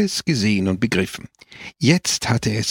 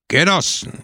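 shouted; 7 semitones higher than usual. A narrator's reading. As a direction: call the comrades to attention. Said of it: "schrie Pepone". (laut gerufen)

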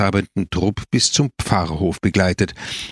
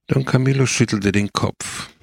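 ordinary delivery

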